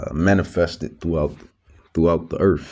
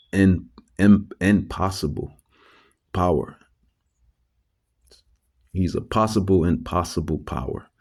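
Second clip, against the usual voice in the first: normal talking voice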